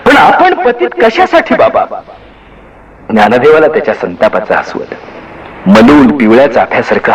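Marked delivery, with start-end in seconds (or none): 0.0-1.9 irritated retort. A narrator's reading. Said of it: "निवृत्ती जास्तच चिडला".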